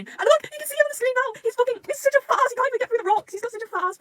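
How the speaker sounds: high, loud giddy fan squeal. Real, not acted. Fan to viewers, giddy over the fat cat. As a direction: gush at the silly drawing.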